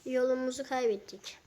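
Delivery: usual speaking voice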